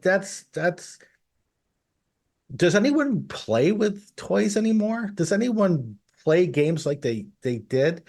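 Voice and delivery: high-pitched mock disbelief; incredulous questioning. Co-host questioning whether toys still get played with, incredulous. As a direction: voice a doubtful reaction, starting high and easing down. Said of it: "And I'm like".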